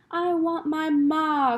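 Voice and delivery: high, slow, loud wail for her mother; a slow, pleading wail; crying out. A narrator's reading. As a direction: cry it out like a lost child. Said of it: "cried Meg".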